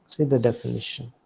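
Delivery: normal talking voice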